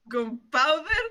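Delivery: loud, incredulous question breaking into laughter; laughing, can't believe it; amused disbelief. Acted. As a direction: shoot up high in giggly astonishment.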